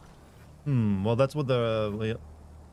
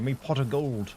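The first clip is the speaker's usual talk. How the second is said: put-on light Irish leprechaun accent; a hint of a cheeky leprechaun. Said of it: "doing the voice".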